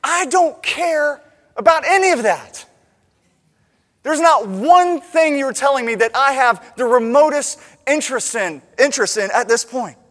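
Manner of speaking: loud, high, emphatic disinterest; exasperated inner outburst. Acted. Bored listener's secret thought about friends' chatter, loud exasperation. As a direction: burst out with what you secretly think. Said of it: "and I just think".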